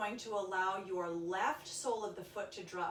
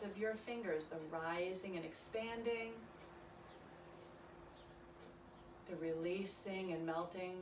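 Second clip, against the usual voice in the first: soft, calm yoga meditation voice; quiet guided meditation for a yoga class; guided relaxation; 2 semitones lower, 5 dB quieter than usual. Acted. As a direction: ease them into their breath gently.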